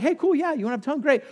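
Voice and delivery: lightly acted casual go-ahead; Paul, casually agreeable. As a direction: toss it off like an easygoing okay. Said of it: "Paul's clear. He's like".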